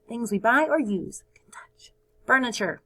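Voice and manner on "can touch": whisper. Acted. Whispered definition.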